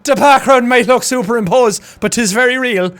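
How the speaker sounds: lively mimicked Cork lilt; Cork Irish accent. Streamer repeating the rowers' interview, Cork accent. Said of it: "a real fucking Irish accent".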